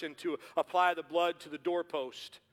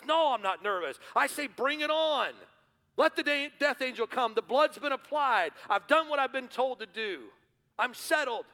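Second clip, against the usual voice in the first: higher, louder, bold and sure of himself; confident Smith; 8 semitones higher, 6 dB louder than usual. Retold or imitated. A neighbour boasting to his nervous friend, bold and higher. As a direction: say it like nothing can shake you. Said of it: "He goes".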